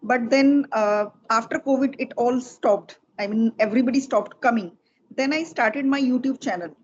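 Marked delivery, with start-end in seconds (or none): none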